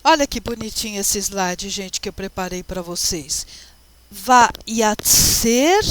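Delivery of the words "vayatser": Vayatser sounded out in loud, separated syllables; careful Hebrew reading. Shown as a demonstration. Teacher reads the Hebrew verse to students, Vayatser slowly sounded out. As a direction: read out Vayatser syllable by syllable, rising at the end.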